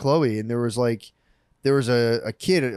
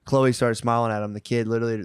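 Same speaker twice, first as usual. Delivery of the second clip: ordinary delivery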